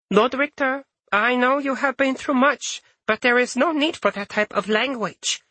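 put-on high, gentle old woman voice; a prim old fairy chiding; elderly sprite voice. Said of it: "Sumiko spoke up".

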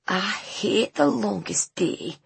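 slow, grudging grumble; sullen teenager. Acted. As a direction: drag it out in disgust. Sullen teenager voiced in story.

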